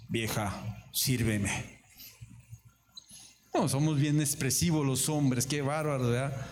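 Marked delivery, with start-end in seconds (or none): 0.1-1.8 grave y seco, marido rudo; ordénale sin cariño, voz grave; marido mandón. Pretend. Esposo ordena comida. (el esposo le ordena a su mujer que le sirva, grave y seco)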